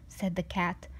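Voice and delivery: ordinary delivery, nothing marked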